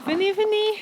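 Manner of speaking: high-pitched coaxing call; calling animals to food. Real, not acted. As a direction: call the flock sweetly, high up. Calling the sheep to eat. (appel aigu aux moutons)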